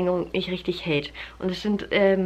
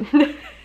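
amused laugh; 6 semitones higher, 6 dB louder than usual. Real, not acted. Laughing about kitchen hair product.

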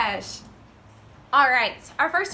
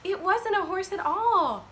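high, drawn-out no; exaggerated storybook denial; emphatic no; 7 semitones higher, 3 dB louder, slower than usual. A narrator's reading. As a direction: let the children know it's wrong. Emphatic answer to the children.

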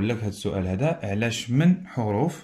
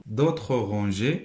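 slow reading; much slower than usual. Shown as a demonstration. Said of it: "Alignées en dessous".

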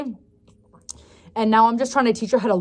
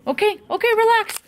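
high soothing puppy talk; 11 semitones higher than usual. Real, not acted.

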